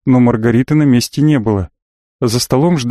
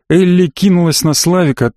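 ordinary delivery, nothing marked

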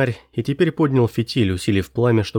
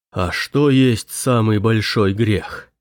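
slow, drawn-out; much slower than usual. Acted. Priest asks slowly.

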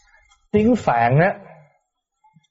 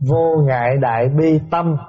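usual speaking voice

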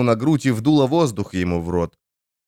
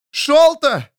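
desperate high call across water; shouting a name; 4 dB louder than usual. Acted. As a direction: yell the name out loud. Calling out for drowning man.

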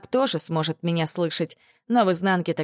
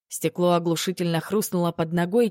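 ordinary delivery

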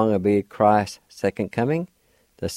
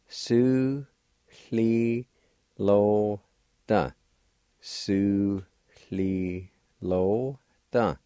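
Su, li, lo, da with a pause after each; syllable by syllable; much slower than usual. Shown as a demonstration. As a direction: break Su li lo da into beats. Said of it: "Number two, bushel".

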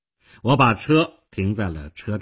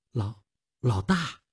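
slow, hesitant stammer; taken aback and less cocky; arrogance deflating; 2 semitones higher than usual. A narrator's reading. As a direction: falter on the first word, cowed. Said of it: "他的傲慢收敛一点".

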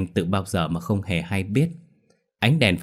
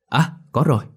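bright happy exclamation, higher; exclaiming; 6 semitones higher, 3 dB louder than usual. A narrator's reading. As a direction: cry out in relief at finding it. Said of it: "thì anh reo lên". (reo lên vui mừng)

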